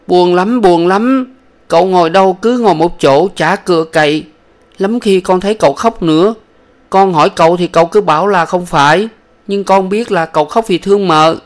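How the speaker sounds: put-on high childlike voice, sad and earnest; little boy voice. Small son telling his sick mother about father, high childlike voice.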